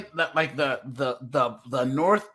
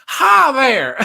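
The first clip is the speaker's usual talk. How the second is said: much higher, loud brash American hello; boorish American greeting; 9 semitones higher, 11 dB louder than usual. Retold or imitated. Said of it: "everyone thinks we're boorish 'cause we're like".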